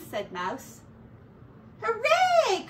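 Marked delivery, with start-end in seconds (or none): 1.8-2.7 very high, loud hooray; joyful cheer. A narrator's reading. Ratty cheers at the cheese, overjoyed. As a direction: cheer with delight. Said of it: "cried Ratty".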